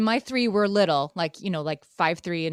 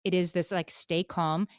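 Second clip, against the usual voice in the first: usual speaking voice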